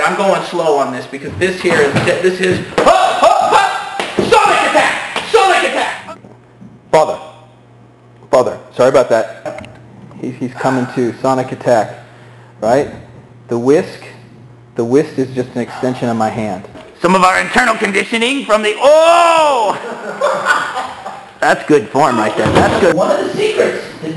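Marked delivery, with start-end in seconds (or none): 2.8-6.2 high shouted attack call; battle cry. Pretend. 18.8-21.4 burst of laughter. Real, not acted. Sudden laugh at hit.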